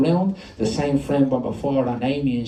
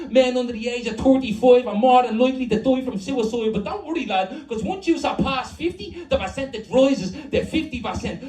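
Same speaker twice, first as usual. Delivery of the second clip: high-pitched furious rant; outraged tirade, pitched far up; pent-up rage; 11 semitones higher than usual. Acted. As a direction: pour it all out in one breath. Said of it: "the rage that's been built up".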